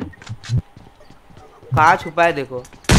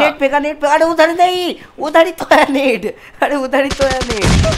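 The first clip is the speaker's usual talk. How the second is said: panicking gamer; 8 semitones higher, 4 dB louder than usual. Real, not acted. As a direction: yell directions in a panic. Grenade thrown, frantic directions.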